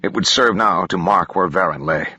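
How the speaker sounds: ordinary delivery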